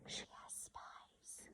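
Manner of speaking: slow secret whisper. A narrator's reading. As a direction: breathe it like a secret. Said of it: "I whispered".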